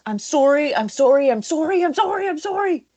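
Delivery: high-pitched, climbing run of apologies; mock yelled apology. Retelling Craig's apology to co-hosts, high mock yell. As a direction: plead it over and over, pitch rising. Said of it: "I love when he's yelling".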